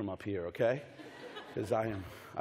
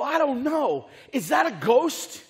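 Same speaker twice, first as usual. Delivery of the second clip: higher, uncertain mock-fearful voice; lightly acted alarm; acted bewilderment; 11 semitones higher, 5 dB louder than usual. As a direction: voice a puzzled onlooker, straining to make it out. Voices the fearful, unsure disciples.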